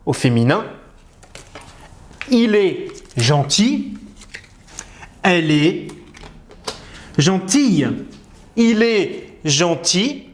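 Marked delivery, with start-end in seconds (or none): 2.3-7.9 Il est gentil said very slowly, gentil ending "ti"; teacher drilling Il est gentil slowly; slow model sentence. Shown as a demonstration. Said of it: "slow model sentence". 8.6-10.3 Il est gentil said very slowly, gentil ending "ti"; teacher drilling Il est gentil slowly; slow model sentence. Shown as a demonstration. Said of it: "slow model sentence".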